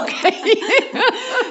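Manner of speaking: high delighted laugh. Real, not acted. Coach laughing at her own enthusiasm, high laugh. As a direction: laugh freely.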